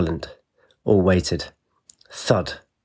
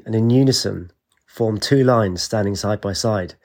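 plain reading voice

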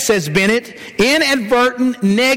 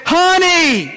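higher, raised call of Honey; a husband testing his wife's hearing, calls out; loud call; 8 semitones higher, 3 dB louder than usual. Retold or imitated. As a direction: call out Honey to a wife who can't hear. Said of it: "in a loud voice".

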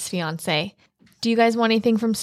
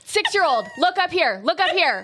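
higher, bossy crowd-control voice; mock drill sergeant; 9 semitones higher than usual. Friend plays her marshalling the barn about Julia. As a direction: order the whole barn around like a camp counselor.

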